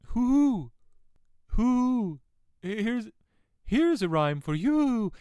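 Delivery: high, sing-out owl impression; playful high-pitched storybook owl; hooting owl. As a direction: hoot it high, then announce slowly like an owl. Acting out owl who talks in rhyme.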